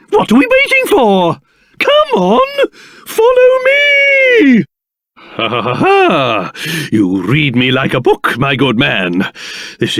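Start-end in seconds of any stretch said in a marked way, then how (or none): 0.1-4.6 rally them along, soaring high; eager rallying call. Acted. Eagerly urging others to follow. 5.2-6.5 theatrical chuckle. Acted. Chuckles at being read.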